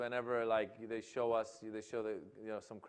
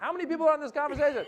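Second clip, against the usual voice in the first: exasperated shout; 14 semitones higher, 9 dB louder, much faster than usual. Retold or imitated. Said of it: "and you're like".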